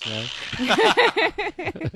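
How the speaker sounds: man breaks into a high laugh; laughing jab. Real, not acted. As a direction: crack up as you tease him.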